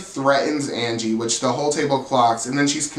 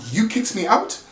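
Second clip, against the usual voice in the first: higher, put-on British indignation; mock British accent; 9 semitones higher than usual. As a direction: imitate an affronted pseudo-British question. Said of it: "has a random British accent".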